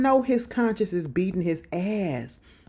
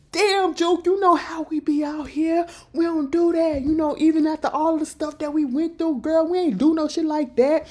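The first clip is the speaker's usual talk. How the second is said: higher, lightly voiced pleading; pleading captive; 7 semitones higher than usual. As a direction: talk her down, pleading.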